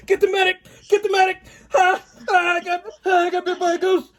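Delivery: high-pitched cries for help; mock panic. Ghost hunter bitten and running back, mock panic. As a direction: play a hammed-up wounded victim calling out.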